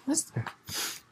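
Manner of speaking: normal talking voice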